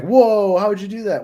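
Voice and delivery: high, loud "whoa" of amazement, then easing off; freaking out. Retold or imitated. Imitating Spider-Man seeing natural web shooters, freaking out. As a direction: imitate someone stunned, voice leaping up at first. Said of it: "I love how they're freaking out".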